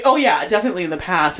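usual speaking voice

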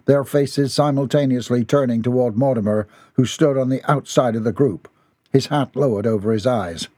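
ordinary delivery, nothing marked

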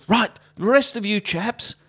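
high-pitched mock-peeved officer's orders; peeved colonel. Colonel sending the rest of his chaps uphill, peeved. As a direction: play the exasperated colonel, pitched well up. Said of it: "quite peeved".

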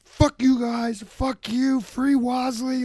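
high, slow mock drunk yelling at cops; drunk cussing. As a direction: ham up a shirtless drunk mouthing off. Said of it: "cussing at the cops".

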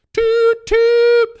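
high, loud toot toot like a train whistle; hammed-up train horn call; playful steam whistle imitation; mock train whistle.

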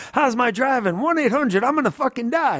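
high hammed-up panicked hero; imitating a panicking hero; mock panic. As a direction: play the doomed guy on the runaway gurney. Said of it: "he's just like".

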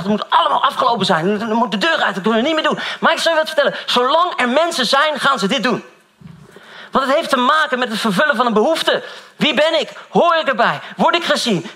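fired-up sermon voice; full-force preaching. Acted. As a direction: preach it out to the whole hall.

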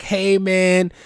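high, loud, excited mock fan; grateful fan. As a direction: gush like a changed reader. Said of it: "they're gonna be like".

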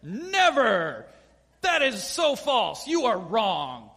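loud, slow, indignant refusal; slow loud indignant protest; indignant scolding. Retold or imitated. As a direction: scold him hard. Peter scolds Jesus.

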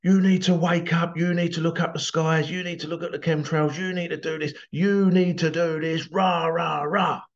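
mock an all-caps troll yelling orders; mock shouty. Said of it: "all in capital letters, shouty, shouty".

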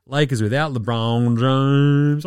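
usual speaking voice

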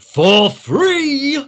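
loud, high-pitched shout of disbelief; explosive, shrieked exclamation; outraged yell. A narrator's reading. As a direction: yell it out as a scandalised miser, leaping up in pitch. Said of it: "B Z yelled".

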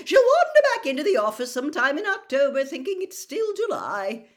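loud, high, breezy heartiness; booming, carefree reassurance; jovial Bagman, unconcerned. A narrator's reading. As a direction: chuckle it off without a care. Narrator plays Bagman, breezily unconcerned.

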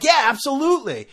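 high, loud eager agreement; oblivious agreement. Retold or imitated. Son taking his father's sarcasm seriously, eagerly agreeing. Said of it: "And I'm like".

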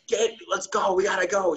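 high, urgent mock panic; husband urging wife to leave, high and frantic; panicked. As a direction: pitch way up, rush them out the door. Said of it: "I was like".

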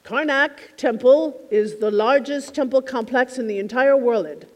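imitating a light Arabic accent. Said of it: "He also had the most charming Arabic accent".

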